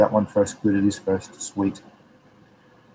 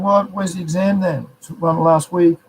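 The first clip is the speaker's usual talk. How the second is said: mock surprise; 7 semitones higher than usual. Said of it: "You'd be going".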